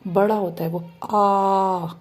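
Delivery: आ held long and open; teacher drawing out a long आ. Shown as a demonstration. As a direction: stretch आ out into a long sound. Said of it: "लंबा sound निकलता है".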